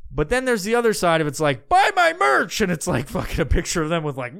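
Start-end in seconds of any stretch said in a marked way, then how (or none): 1.7-2.6 much higher, louder sales squeal; mock pitch. Mocking merch-selling page.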